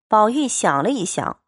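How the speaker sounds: ordinary delivery, nothing marked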